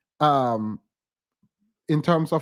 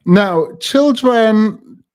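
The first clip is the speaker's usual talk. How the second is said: mock stern lecture; 3 semitones higher, 10 dB louder, much slower than usual. Scolding address to the audience.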